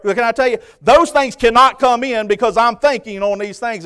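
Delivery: loud, driving scripture-laden preaching; full-force preaching. Real, not acted. Preacher teaching church what to think on, full-force preaching. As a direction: build in pitch and force through the list.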